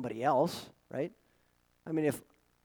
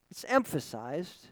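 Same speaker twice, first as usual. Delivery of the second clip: ordinary talk, nothing marked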